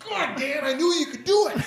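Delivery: high, laughing congratulation; excited cheer. Pretend. Guest hears the fan made it, whooping proudly.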